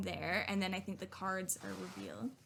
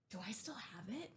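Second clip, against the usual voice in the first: whispered to herself, much softer; 2 semitones lower, 12 dB quieter than usual. Real, not acted. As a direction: whisper the question to yourself. Wondering aloud to herself.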